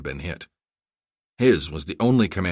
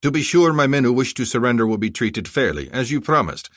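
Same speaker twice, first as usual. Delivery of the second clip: proud general; 5 semitones higher, 5 dB louder than usual. Acted. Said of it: "Bushazi radioed".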